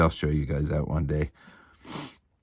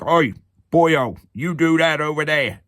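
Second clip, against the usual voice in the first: higher, louder Cockney orc voice; 8 semitones higher, 3 dB louder than usual. Retold or imitated. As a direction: bark it like a Cockney orc ordering a goblin around. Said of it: "made him sound like a orc".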